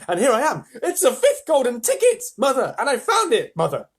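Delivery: put-on high, loud, tumbling excited boy; excited outburst. A narrator's reading. Boy tells mother he found the ticket, wildly excited. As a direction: gush it all out, tripping over words.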